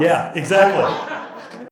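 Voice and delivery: slow, high laughing yeah. Real, not acted. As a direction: laugh through the words.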